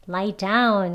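DOWN with a full, stopped d; unflapped "lie down"; hard d. Shown as a demonstration. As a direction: say "lie down" with a firm Korean ㄷ in DOWN. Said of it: "하지 않아요".

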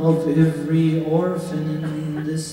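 slow, half-sung grieving lament; mournful lament. Acted. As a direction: draw out each phrase like a dirge. Mourning lament to Ibn Muljam.

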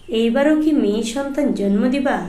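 ordinary delivery, nothing marked

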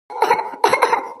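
coughing on swallowed water; acted choking fit. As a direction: splutter and cough as if drowning. Water got into her mouth.

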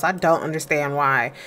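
usual speaking voice

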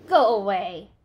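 loud grumpy snap; teacher voicing a grouchy bug; grouchy ladybug. A narrator's reading. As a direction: shove the words out crossly. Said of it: "said the grouchy ladybug".

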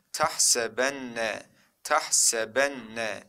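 "Tahsebenne" read slowly, syllable by syllable; model reading. Shown as a demonstration. Teacher reads the next word for students, slow model reading. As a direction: draw out each syllable of "Tahsebenne". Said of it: "Hangisiymiş arkadaşlar?".

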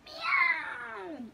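high, loud yowling meow; loud pained cat yowl. Acted. Cat run over by snot ball.

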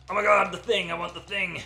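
high, loud eager cry; excited gamer yell; excited outburst. Real, not acted. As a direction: blurt it out, wanting it badly. Spots item he wants in game.

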